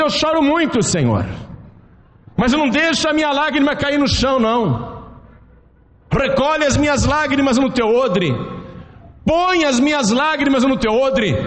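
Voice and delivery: a lightly voiced David begging God to keep his tears; pleading prayer.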